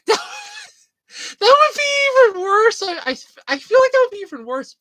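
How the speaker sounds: high, loud words through real laughter; barely getting it out between laughs; laughing. Real, not acted. Laughing at the place name, high and loud.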